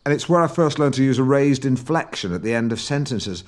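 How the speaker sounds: ordinary delivery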